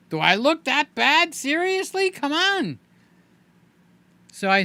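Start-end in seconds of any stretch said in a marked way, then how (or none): 0.1-2.8 much higher, indignant do I look that bad; mock affronted, high and pleading; offended friend. Friend given moisturizer reacts, high mock offense. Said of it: "Another friend is like".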